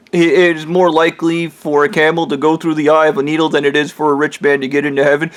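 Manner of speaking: normal talking voice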